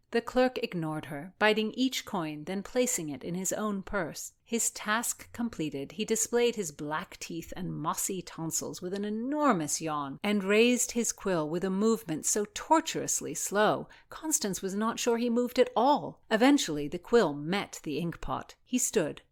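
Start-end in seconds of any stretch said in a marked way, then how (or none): none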